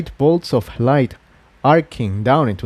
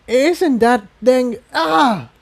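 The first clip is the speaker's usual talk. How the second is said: put-on higher young voice, cut off by a cry of pain; Spike's voice breaking off; 12 semitones higher, slower than usual. A narrator's reading. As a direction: start the worry, then yelp as the pain hits.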